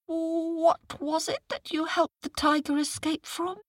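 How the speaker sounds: high, dopey questioning jackal; puzzled jackal. Acted. Jackal playing dumb.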